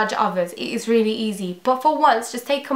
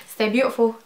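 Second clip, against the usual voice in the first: ordinary delivery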